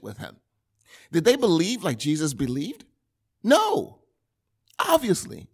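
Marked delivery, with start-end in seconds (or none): 3.4-5.5 emphatic no. Real, not acted. Rhetorical answer to own question.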